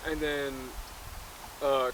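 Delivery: normal talking voice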